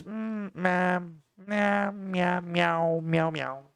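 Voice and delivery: high-pitched mock cat meows; playful meowing. Card reviewer stalling while unsure, playful meowing.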